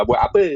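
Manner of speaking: "apa" ending in E, "ape"; Perak dialect ending. Shown as a demonstration. Student gives a Perak example, "apa" said as "ape". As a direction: ask "buat apa" with the final A as E. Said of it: "Biasa Perak E dia macam".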